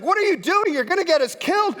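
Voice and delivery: high, loud frantic yell, fast at the end; panicked yelling. Retold or imitated. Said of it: "He's, he's like".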